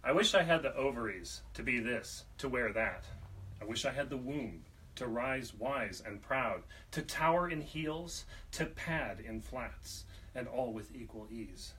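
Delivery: rhythmic spoken-word delivery, phrase by phrase; poetry reading. Acted. Poet reading a poem of wishing for ovaries, emphatic poetry reading. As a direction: lift the key phrases, then let them fall. Reads own poem aloud.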